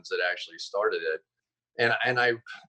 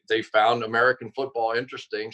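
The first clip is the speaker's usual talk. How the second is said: ordinary talk, nothing marked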